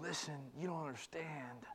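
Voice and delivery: hushed whisper as Jesus. Retold or imitated. Jesus whispering to the doubting listener. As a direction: lean in and whisper it. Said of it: "But Jesus is saying".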